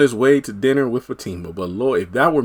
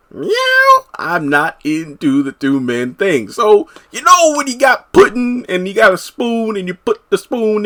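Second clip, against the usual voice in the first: higher, rambling comic Cosby voice; higher jokey Cosby riff with a squeaky meow; Bill Cosby impression; 6 semitones higher, 4 dB louder than usual. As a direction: ham up a Cosby-style sermon on pudding. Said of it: "it sounded like he was kinda doing a Cosby thing".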